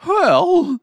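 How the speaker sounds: high, loud donkey exclamation; exclaiming donkey. A narrator's reading. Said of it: "the donkey exclaimed".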